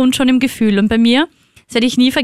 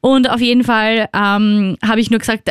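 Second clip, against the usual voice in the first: ordinary delivery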